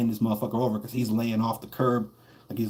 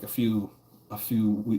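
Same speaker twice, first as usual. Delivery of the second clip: ordinary talk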